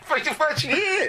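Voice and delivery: high, slow, incredulous; disbelieving. Real, not acted. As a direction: stretch it out in disbelief. Stressing disbelief.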